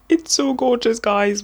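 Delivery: high, delighted "It's so gorgeous"; gushing. Real, not acted. To viewers, holding up the book, gushing. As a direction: swoon at the viewers.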